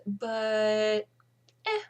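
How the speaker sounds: ordinary talk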